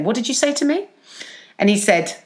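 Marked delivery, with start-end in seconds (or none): 0.0-0.9 higher-pitched, rising challenge; challenging question. Retold or imitated. Worker first questions a threatening colleague, higher rising challenge. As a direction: ask it back in disbelief, pitch lifting at the end. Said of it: "I just remember saying".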